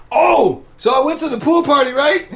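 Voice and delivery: loud, high-pitched burst of excitement; sudden recollection. Real, not acted. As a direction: burst out as a story comes back to you. Suddenly remembers a story to tell.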